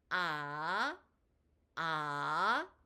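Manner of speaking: "아" held long, starting low and rising steeply like a question; second-tone rise. Shown as a demonstration. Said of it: "낮은 음에서 높은 음으로 올라가는". ("아"를 낮은 음에서 높은 음으로 길게 끌어올리기)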